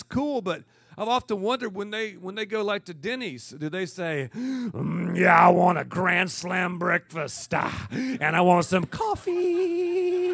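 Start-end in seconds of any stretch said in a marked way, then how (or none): 4.3-10.3 louder, slow, drawn-out pulpit voice; wind-sucking preacher. Retold or imitated. Joking about preachers ordering at Denny's, hammed-up pulpit tone. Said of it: "wind-sucking preachers".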